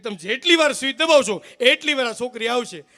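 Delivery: loud, emphatic explanation; exasperated explaining. Retold or imitated. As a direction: spell it out loudly, at the end of patience. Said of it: "મેં કીધું".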